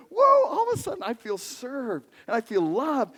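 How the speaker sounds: usual speaking voice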